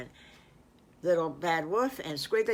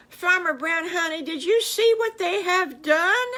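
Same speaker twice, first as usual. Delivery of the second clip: much higher, louder excited call; excited wife; 12 semitones higher, 8 dB louder than usual. A narrator's reading. Wife excitedly showing husband the kids' gift. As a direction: call her husband over in delight.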